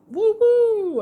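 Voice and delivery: high, loud whooping; imitated crowd cheer. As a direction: whoop like an applauding audience.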